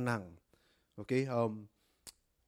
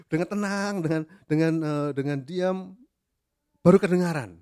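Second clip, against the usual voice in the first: emphatic preaching; 3 semitones higher, 7 dB louder than usual. Real, not acted. Preacher stresses stillness.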